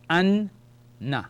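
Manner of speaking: "an", a pause, then "na"; syllable by syllable. Shown as a demonstration. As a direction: sound out "an, na" as two separate syllables. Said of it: "gives me the sound of".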